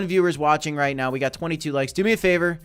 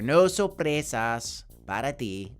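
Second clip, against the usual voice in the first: slow, playful switch into Spanish; 4 semitones lower, slower than usual. Pretend. Crypto host teasing viewers in Spanish, slow and playful. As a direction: toss the line off in mock Spanish, slowly. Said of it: "Don't know why I went to Spanish".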